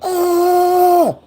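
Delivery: very high, strained yelp of pain; imitated scream. Tased man screaming at the officer, high pained yelp. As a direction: scream like the current is running through you. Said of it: "First he screaming".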